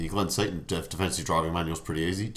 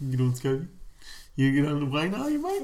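cheering; 8 semitones higher than usual. Real, not acted. Cheering on a driver on screen.

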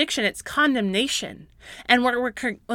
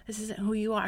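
much softer, tender encouragement; Holy Spirit's voice; 9 dB quieter than usual. Retold or imitated. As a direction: gently lift someone up, very soft. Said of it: "It says".

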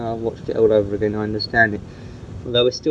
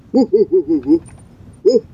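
mock woofs, higher and louder; imitated dog bark; 5 semitones higher, 4 dB louder than usual. Shown as a demonstration. Owner barking to coax the dog, mock woofs. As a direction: bark to show the dog how. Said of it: "but bark".

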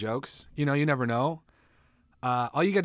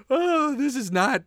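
laughing while talking; 12 semitones higher, 3 dB louder than usual. Real, not acted. Laughing through words.

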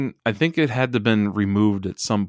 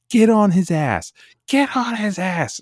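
high, loud screamed yelling, mimicking Piper; imitated ringside scream; 12 semitones higher, 5 dB louder than usual. Co-host imitating Piper screaming at his wrestler from ringside. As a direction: bellow it from ringside like a manager losing it. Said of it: "You can hear him screaming".

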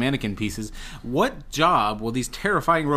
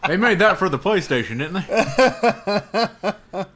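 put-on teasing old person voice; 6 semitones higher, slower than usual. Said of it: "That is the oldest person thing you've ever said".

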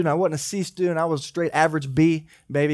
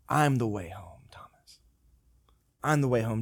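gentle Jesus; 4 semitones lower, 4 dB quieter than usual. Retold or imitated. Jesus answering Thomas.

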